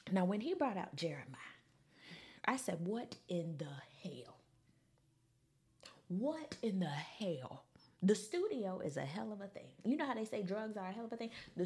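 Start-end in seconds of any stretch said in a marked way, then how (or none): 2.8-7.6 low, breath-heavy disbelief; stunned. Retold or imitated. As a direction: drag it out under your breath, aghast. Retold stunned reaction to bad singing.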